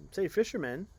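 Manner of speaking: high, loud worried question; worried passengers. Acted. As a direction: ask nervously as a passenger. Passengers ask the fisherman, worried.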